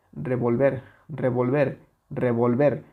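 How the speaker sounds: revolver with VOL unstressed, weight on final VER; stress off the penultimate. Shown as a demonstration. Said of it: "Si le quitamos esa intensidad a la penúltima sílaba".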